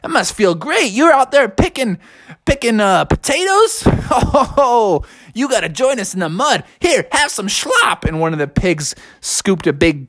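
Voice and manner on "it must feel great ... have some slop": high, chummy pig voice. Acted. Pig coaxing the bear into the mud, high and chummy. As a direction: play the pig urging the bear in.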